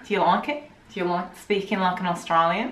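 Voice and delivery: put-on Australian accent; easygoing imitation Australian accent. Shown as a demonstration. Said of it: "this is our Australian accent".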